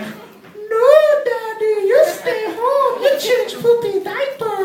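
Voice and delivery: put-on high whiny baby voice. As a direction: talk like a pleading toddler. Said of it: "Baby's like".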